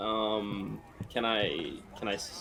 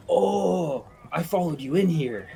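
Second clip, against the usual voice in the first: character voice; 7 semitones higher, 5 dB louder than usual. Acted. Warforged character's first line.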